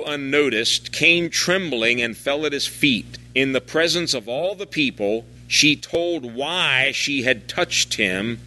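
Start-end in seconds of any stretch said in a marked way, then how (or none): none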